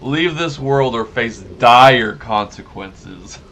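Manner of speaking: slow, loud, mock-dramatic threat; hammed-up doom, slow and loud; mock ominous reading. Reads a threatening note aloud.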